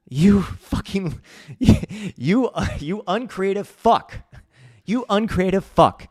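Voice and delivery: loud, high, drawn-out insult; slow, hammed-up indignant yelling; mock outrage. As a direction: berate them in exaggerated exasperation. Mock tirade at meme users.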